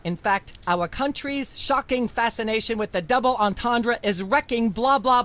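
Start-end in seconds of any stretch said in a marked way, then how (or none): none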